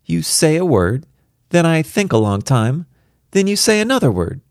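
put-on high, impatient little girl; a cross child explaining; irritable child voice. A narrator's reading. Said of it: "childishly irritable about it".

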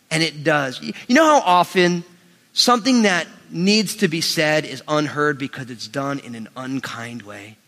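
ordinary talk

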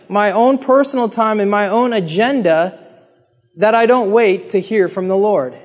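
ordinary talk, nothing marked

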